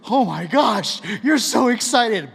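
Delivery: loud, high burst of giddy delight; hammed-up thrilled gushing; mock dog excitement. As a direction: play an overexcited dog. Acts out the dog's delighted reaction.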